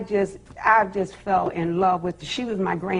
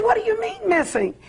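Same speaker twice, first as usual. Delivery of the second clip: high, incredulous question; alarmed retelling; 11 semitones higher than usual. Retold or imitated. Mother reacting on the phone to the missing dog, alarmed. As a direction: shoot the pitch up in disbelief. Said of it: "I said".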